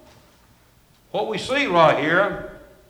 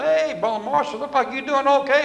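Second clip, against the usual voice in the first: imitated friend calling out, much higher; high-pitched greeting; 8 semitones higher than usual. Brother Bill greeting him on the steps, high-pitched call.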